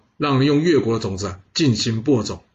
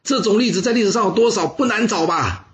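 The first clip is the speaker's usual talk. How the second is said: high, heated pleading that slows on the last question; agitated protest; 9 semitones higher than usual. Acted. Wu Zixu protests to the king, voice high and agitated. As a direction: argue hotly, pitch climbing with frustration. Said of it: "他激动地说".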